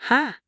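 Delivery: sharp mocking ha; a scoffing snort of a laugh; scornful laugh. A narrator's reading. Scornful retort on phone.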